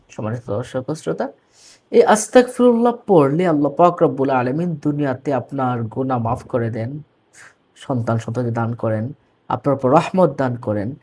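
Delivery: normal talking voice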